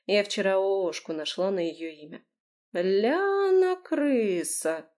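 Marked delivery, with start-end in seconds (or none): 2.7-4.9 very slow, stretched-out drawl; higher, very slow drawl; drawn out. A narrator's reading. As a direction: draw out every sound, savouring it. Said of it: "протянула Лера".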